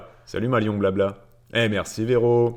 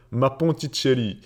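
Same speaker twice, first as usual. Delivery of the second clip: put-on "Ponticelli" in a higher, playful Italian accent; Corsican or Italian accent; 4 semitones higher than usual. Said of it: "l'accent corse ou l'accent italien".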